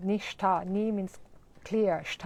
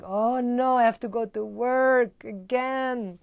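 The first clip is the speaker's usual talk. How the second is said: mock dread; 3 semitones higher, 6 dB louder, slower than usual. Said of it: "you're like".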